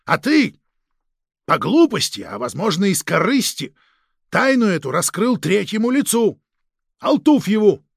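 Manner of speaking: stern angry reprimand. Acted. Chief scolds an agent for leaking secrets, angry and forceful. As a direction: lay into him with loud, forceful emphasis.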